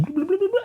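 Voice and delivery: bubbling chirped very high as a bubble noise; imitating fish tank bubbles. Shown as a demonstration. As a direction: make the word bubbling sound like tank bubbles. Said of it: "that bubbling noise".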